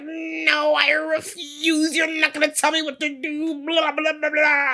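loud, very high mock wail; cranked-up mock tantrum. Podcaster mimicking the cranked-up character refusing, high mock tantrum. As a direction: send up a stubborn refusal, shrieking into nonsense. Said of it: "they really cranked him up".

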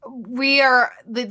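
ordinary talk, nothing marked

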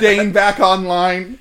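slow, loud robot announcement. Pretend. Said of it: "robot, robot Dane?".

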